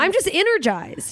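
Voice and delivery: high, chirpy self-justification; mock-energized lilt; upbeat denial. Voicing a manic person's denial.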